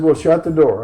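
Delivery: mock-urgent command to the secretary; acted urgent order. Calling an order to his secretary.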